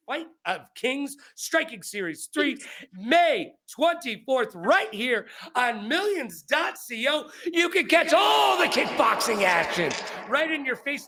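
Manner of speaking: high-pitched announcer hype, drawn out on the date; punchy promo read building to a shout; fight promoter plug. Real, not acted. Plugs the upcoming fight.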